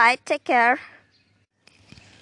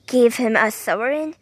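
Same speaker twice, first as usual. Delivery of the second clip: ordinary delivery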